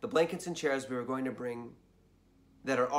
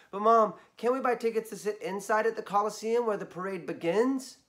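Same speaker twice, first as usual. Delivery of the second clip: put-on higher, whiny kid plea; pleading child; 7 semitones higher, 3 dB louder than usual. Ryan begs Mom for Coliseum tickets, pleading. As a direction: beg mom like a little kid.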